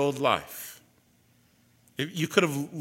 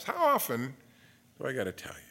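fading to a whisper. Shown as a demonstration. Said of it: "the Lord says that to you".

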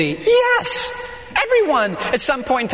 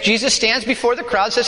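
usual speaking voice

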